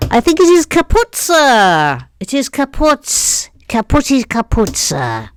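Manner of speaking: high, quick comic report; mock report. Reports the broken mic to viewers in a silly voice. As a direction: play a silly servant reporting.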